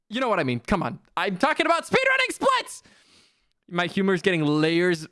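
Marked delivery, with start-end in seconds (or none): 1.2-2.8 high-pitched protesting cry; worked-up yelp, pitch shooting up; exasperated outburst. Real, not acted. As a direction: insist shrilly that you were misunderstood. Repeats clarification in mock exasperation.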